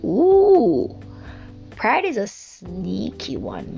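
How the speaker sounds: ordinary talk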